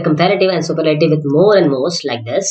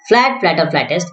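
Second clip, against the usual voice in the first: usual speaking voice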